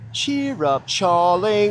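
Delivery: a light, breezy Cockney "Cheer up, Charlie"; Cockney accent. Retold or imitated. Teasing a Charlie with a mild Cockney voice. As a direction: toss off a mild Cockney taunt. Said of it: "with a Cockney accent".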